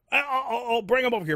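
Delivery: hammed-up eager friend; mock eager. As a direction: squeal it like a kid offered sweets. Friend's eager reply retold.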